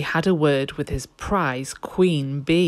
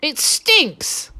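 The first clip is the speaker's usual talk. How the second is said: disgusted; 12 semitones higher than usual. A narrator's reading. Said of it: "Len said, "Pooh".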